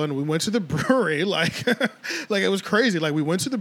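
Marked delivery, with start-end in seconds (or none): 1.7-2.2 high giggling burst; hysterical laugh. Real, not acted. Laughing at own story.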